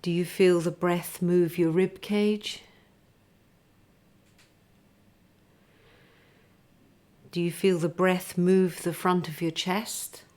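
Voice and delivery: unhurried meditation teacher; guided body scan. Acted. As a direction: lead them gently into stillness, breath by breath.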